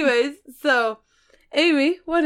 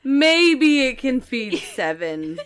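mock customer; 2 semitones higher, 6 dB louder, much slower than usual. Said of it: "She said".

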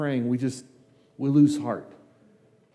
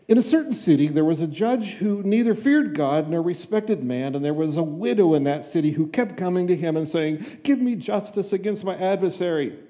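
higher-pitched, projected Bible reading; scripture reading; 5 semitones higher than usual. A narrator's reading. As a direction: read the parable out to the congregation, voice lifted. Reads parable aloud from Bible.

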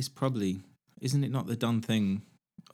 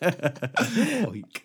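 laughing repeat; 8 semitones higher, 5 dB louder than usual. Real, not acted.

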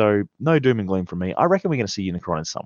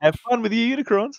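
mock taunt; 13 semitones higher than usual. Hasbro taunting third-party makers, high mock taunt. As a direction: tease the bootleg makers lightly. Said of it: "And to all the third party people out there".

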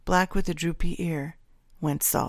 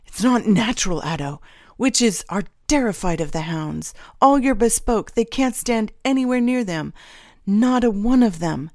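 heated, raised-voice protest; arguing; 5 semitones higher, 6 dB louder than usual. A narrator's reading. Gage protests to Addo about the girl, arguing. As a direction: argue it higher and louder. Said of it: "Gage argued".

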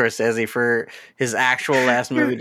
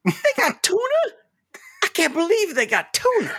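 put-on high excited old lady; high character voice. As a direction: gush about tuna, high. Quoting movie character.

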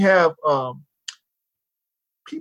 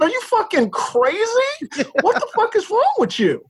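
retold outrage; 14 semitones higher than usual. Driver cursing out a reckless neighbor, high retold outrage. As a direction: voice jumps high in exasperated disbelief.

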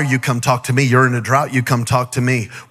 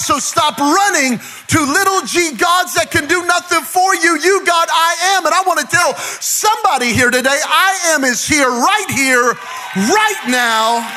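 raised, shouting preacher's climax; full-force preaching; 8 semitones higher than usual. Acted. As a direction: build the room up and shout it out.

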